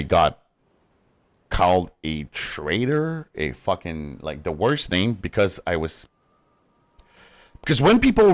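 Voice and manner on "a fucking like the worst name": usual speaking voice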